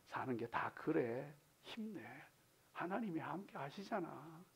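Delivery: soft, low words of sympathy; tender consolation. Acted. Believer comforting a weary fellow believer, tender and hushed. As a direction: console them quietly and gently. Voicing consolation to one another.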